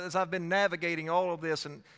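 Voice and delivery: ordinary delivery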